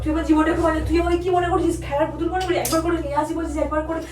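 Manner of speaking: loud, outraged accusation; heated, hurt tirade; angry outburst. Real, not acted. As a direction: throw the accusation back loudly. Wife explodes when told to leave.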